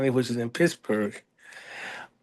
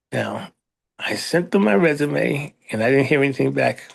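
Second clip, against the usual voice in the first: normal talking voice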